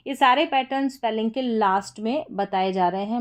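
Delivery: normal talking voice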